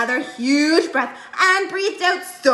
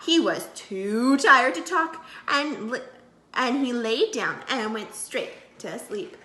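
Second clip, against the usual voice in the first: ordinary delivery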